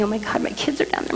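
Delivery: frightened whisper under the breath; terrified whisper. Retold or imitated. Mother seeing the burning earth, frightened whisper. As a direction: breathe it out in dread. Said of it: "I was terrified".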